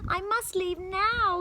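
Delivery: put-on nervous boy. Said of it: "he thought".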